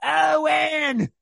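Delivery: high-pitched mock shout; hammed-up, drawn-out yell; imitated mama's holler. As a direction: bellow for your son like an overbearing movie mama. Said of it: "Throw Mama from the Train".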